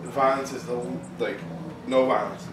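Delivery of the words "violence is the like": ordinary talk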